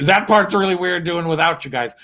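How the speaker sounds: ordinary talk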